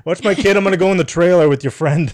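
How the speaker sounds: hammed-up sleazy carnival worker; mock sleazy carny. Mock carny luring parent away.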